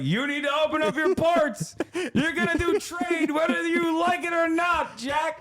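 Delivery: mock Commodore Perry ordering Japan; mock gruff commodore. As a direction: shout it like a pushy general. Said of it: "it was like".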